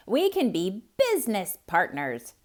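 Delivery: a clever hare rousing lazy Bear; Hare calling. Acted. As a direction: call the sleeping neighbor awake, bright and pushy. Hare pitches his deal.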